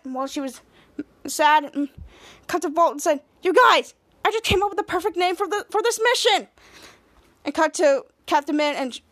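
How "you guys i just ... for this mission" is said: higher, eager retold outburst; excited announcement. Volt to the team with a name, excited. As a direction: burst in with pitch raised.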